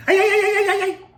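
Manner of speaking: high, loud, yodelling "ay, ay, ay"; hammed-up mariachi cry, high and loud; a shouted falsetto "ay ay ay" war cry; mock war cry. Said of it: "My Mexican war cry".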